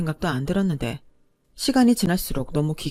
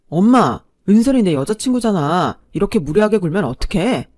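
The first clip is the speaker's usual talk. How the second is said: put-on louder boyfriend objecting to his mother; protesting son; 2 semitones higher, 8 dB louder than usual. A narrator's reading. Boyfriend defending his girlfriend from his mother, protesting. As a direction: push back indignantly as the son.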